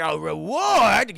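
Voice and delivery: loud, drawn-out greedy delight; greedy excitement. Retold or imitated. Eustace hears of a reward, greedy excitement. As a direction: stretch it out, hungry for money. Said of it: "Eustace is all about the money".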